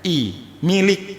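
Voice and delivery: milik read slowly, i vowels pointed out; milik, showing its two i vowels; vowel demo. Shown as a demonstration. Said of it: "ada dua huruf vokal di situ".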